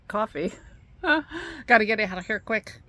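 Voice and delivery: normal talking voice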